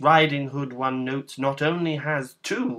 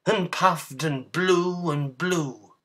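plain reading voice

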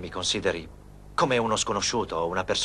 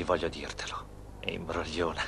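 whispered confession; 4 semitones lower, 7 dB quieter than usual. Acted. Confessing love softly to Rosa.

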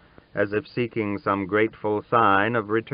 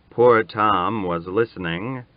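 ordinary delivery, nothing marked

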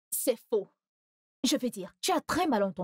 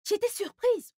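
surprised; 11 semitones higher, 3 dB louder, slower than usual. Acted. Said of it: "j'étais même surprise".

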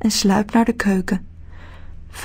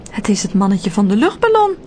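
usual speaking voice

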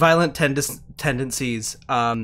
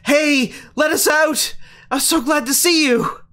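excited call; 10 semitones higher than usual. Acted. Explosives expert calling to her rescuers, high and excited. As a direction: wave and shout for rescue. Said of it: "She waves out".

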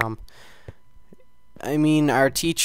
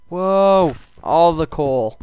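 loud high delighted gasp; loud thrilled discovery; excited whoa; 5 semitones higher, 9 dB louder, much slower than usual. Real, not acted. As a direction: marvel at the treasure. Finds coal in game.

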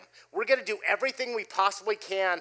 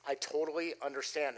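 much softer, low and gentle; very soft, soothing; 5 semitones lower, 11 dB quieter than usual. Retold or imitated. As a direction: ease him down with a soft voice. Calming an angry subcontractor.

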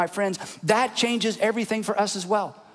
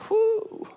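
awed exhale; 13 semitones higher than usual. Real, not acted. Awe after the news.

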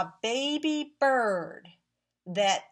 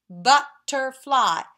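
"butterfly" in three loud beats; "butterfly" firmly split into three; syllable by syllable; 9 dB louder, slower than usual. Shown as a demonstration. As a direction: clap out each syllable of "butterfly". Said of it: "Are you clapping with me?".

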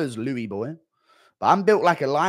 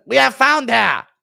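1 German soldier; 11 semitones higher, 9 dB louder than usual. Retold or imitated.

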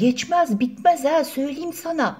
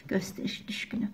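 whispered aside; 14 dB quieter, faster than usual. Acted. Snide aside about show-off.

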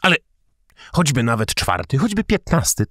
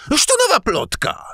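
furious exclamation. A narrator's reading. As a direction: cry out as if stung by gossip. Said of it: "zawołał". (wściekły okrzyk, wyższym głosem)

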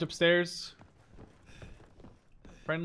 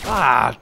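frustrated shout; 12 dB louder than usual. Real, not acted. Frustrated outburst in game.

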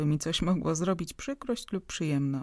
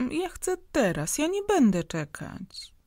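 put-on much higher, insistent little girl; whiny child; 10 semitones higher, 4 dB louder than usual. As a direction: demand it like a spoiled child. (kapryśne dziecko, dużo wyżej, natarczywie)

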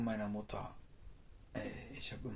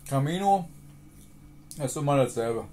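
shouting; 2 semitones higher, 10 dB louder than usual. Real, not acted. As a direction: call it out to everyone. Shouting pilgrim wisdom.

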